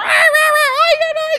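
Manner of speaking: loud, high wordless ranting; mock yelling gibberish. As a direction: squawk like a bickering couple. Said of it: "you're just yelling at each other".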